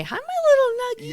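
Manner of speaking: very high cooing pet voice; baby talk. Shown as a demonstration. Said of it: "that stupid voice you do".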